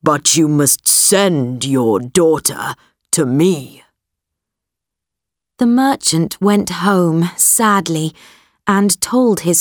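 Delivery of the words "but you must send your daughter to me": deep distorted monster roar. Acted. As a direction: growl like a monster. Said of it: "said the beast".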